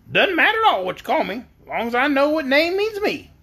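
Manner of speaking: high-pitched, chatty hen voice; squawky-high talking hen; yellow hen. A narrator's reading. Storyteller voicing the yellow hen.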